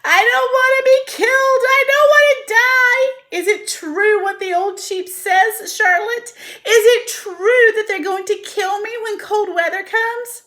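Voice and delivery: high, loud, tearful pleading; crying panic. Acted. Pig crying to Charlotte about being killed. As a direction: sob the questions desperately.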